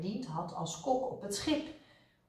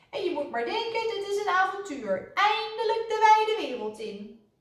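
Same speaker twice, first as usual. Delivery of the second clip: high, excited mouse voice; rally your companion, high and building louder; high-pitched thrill of adventure; eager adventurer; 12 semitones higher, 3 dB louder than usual. A narrator's reading. Mouse cheers on his frightened companion.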